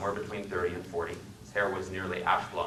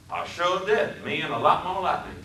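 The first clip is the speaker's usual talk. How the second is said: put-on boastful Southern accent; 9 semitones higher than usual. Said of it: "His speech was the deepest South".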